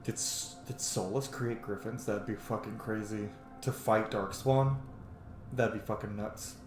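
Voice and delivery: ordinary talk, nothing marked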